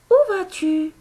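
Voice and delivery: très haut et fort, montée de question; question modèle. Shown as a demonstration. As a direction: lance la question bien haut, pour la classe. Maîtresse lit une question exemple. (question posée très haut et fort)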